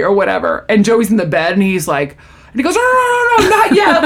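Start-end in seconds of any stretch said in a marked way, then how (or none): 2.7-4.0 very high, panicked mock squeal; Joey. Joey in bed stopping the doctor, panicked. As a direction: rattle off frantic nos.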